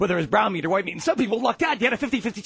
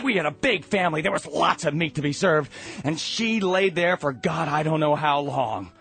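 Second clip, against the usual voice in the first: usual speaking voice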